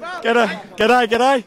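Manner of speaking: put-on light Australian accent on g'day; lightly put-on Australian g'day; mock Australian. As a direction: greet like a jokey Aussie. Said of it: "He does sound like an Australian".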